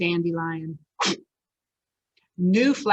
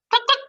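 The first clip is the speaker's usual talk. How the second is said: chicken cluck; 4 dB louder than usual. Acted. Chicken sound again.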